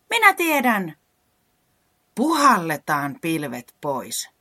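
high, loud burst of inspiration; excited idea. Acted. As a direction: shout out the bright idea. Character gets a sudden idea. (innostunut huudahdus)

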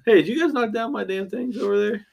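high put-on indignant voice; mock outraged voice. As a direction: ham up being outraged.

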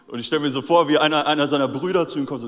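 ordinary talk, nothing marked

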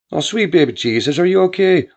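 a frantic ma hearing her son's voice; mother near tears on the phone; worried Irish mother. A narrator's reading.

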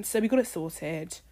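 ordinary delivery

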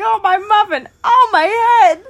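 high, loud wail over a dropped muffin; hammed-up pain and loss, pitched high; mock anguished cry. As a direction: cry out like a sitcom klutz. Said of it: "he's like".

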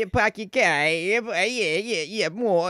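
punchy New York with head-bob swagger; New York accent. Shown as a demonstration. Guest contrasting New York with Boston, biting New York accent. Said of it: "that kind of energy".

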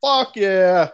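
loud, pumped-up fuck yeah; hyped loud approval; excited shout. Real, not acted. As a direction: cheer it like a fan hyped for the match. Excited agreement about main event.

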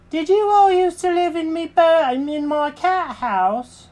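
very high-pitched cartoon cat; Jenkins questioning. Cat questions the birds about his house, very high voice. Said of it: "he goes".

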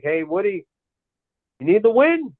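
excited pitch. Retold or imitated. Fan pitching excitedly to team owner.

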